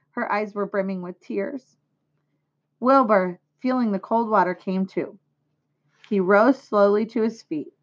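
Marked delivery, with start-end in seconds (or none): none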